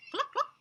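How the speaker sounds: high, loud playful cluck; hen clucking. Acted. Teacher playing the chicken, high loud clucking.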